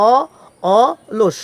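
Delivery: অলস starting on an open "aw", split off; correct pronunciation. Shown as a demonstration. Teacher sounds it out for students, open first vowel in অলস. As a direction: say "aw", then "aw-losh" for অলস. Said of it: "এটার উচ্চারণ হবে কি".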